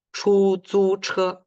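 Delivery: chu zu che slow, high and level, almost sung; all first tone. Shown as a demonstration. Teacher shows the all-first-tone taxi word. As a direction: sing chu zu che on one high pitch. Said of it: "Como cantado".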